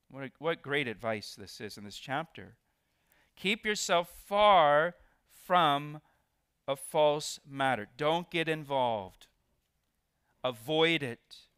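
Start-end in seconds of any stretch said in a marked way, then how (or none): 3.4-7.9 slow, loud "far" held out; stress on far. Shown as a demonstration. Preacher rereading verse, loud, slow "far". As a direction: hit "far" and stretch it. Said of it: "Did you see how I emphasized the word "far".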